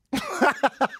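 high-pitched burst of laughter; shrieky tickled laugh; high cackling laugh. Real, not acted. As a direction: crack up at your own joke. Laughs at his own joke.

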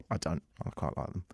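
low, very soft aside; hushed retraction. Acted. Host taking back his jab at Americans, hushed retraction. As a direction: drop to a near whisper.

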